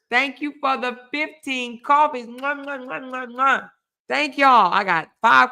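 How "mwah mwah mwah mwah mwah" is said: quick kissing sounds to viewers; blown kisses. Real, not acted. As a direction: blow kisses at the screen. Blowing kisses to donors.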